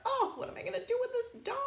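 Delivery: high, fast, fed-up mom voice; exasperated mom. Acted. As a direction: throw up your hands as the frazzled mom. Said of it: "my mom says".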